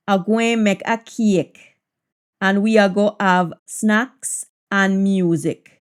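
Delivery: slow Jamaican patois. Shown as a demonstration. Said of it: "patois version of the line".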